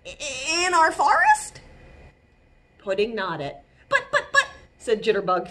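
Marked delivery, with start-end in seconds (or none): 0.1-1.6 high, stammered startled question; cautious chipmunk. A narrator's reading. Jitterbug hearing the snail wants to stay, wary. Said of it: "said Jitterbug cautiously". 3.9-4.5 high, fretful repeated buts; stammering worry. A narrator's reading. Jitterbug thinking aloud about the newcomer, flustered. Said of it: "thinking aloud".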